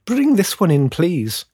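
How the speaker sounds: high, polite command; well-spoken lady. A narrator's reading. Lady having the tree brought inside, well-spoken and high. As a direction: order it in a refined lady's high voice. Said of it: "heard a well-spoken lady announce".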